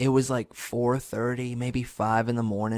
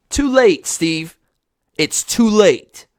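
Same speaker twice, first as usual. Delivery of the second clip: loud, high, drawn-out refusal; mock angry rejection; 8 semitones higher, 9 dB louder, slower than usual. Fan telling Steve off for returning, mock angry. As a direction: shout him down in mock outrage. Rejecting the host's late explanation.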